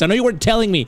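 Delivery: ordinary delivery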